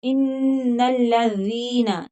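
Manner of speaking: इन्नल्लज़ीना with the long ई on ज़ी drawn out; slow it down and stretch ज़ी; lengthened vowel. Shown as a demonstration. Teacher showing the long vowel in इन्नल्लज़ीना. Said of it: "याए मद्दा है तो दाल पर मद होगी".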